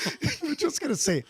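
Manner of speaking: laughing. Real, not acted. Laughing at French-trained dog.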